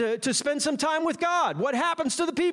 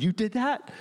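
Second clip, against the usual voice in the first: mock kid. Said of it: "They're going".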